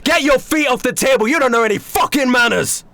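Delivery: high, loud scolding; angry chef. Acted. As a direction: put the guest in his place. Chef scolds table manners.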